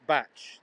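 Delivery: "batch" ending in a tch sound; "batch" to rhyme with match; local pronunciation. Shown as a demonstration. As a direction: say "batch" slowly with a clear tch. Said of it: "we pronounce it batch".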